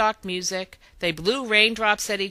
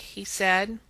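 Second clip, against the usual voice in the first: usual speaking voice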